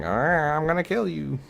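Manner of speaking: high mock-scary killer voice; hammed-up threatening villain. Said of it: "in their best scary voice".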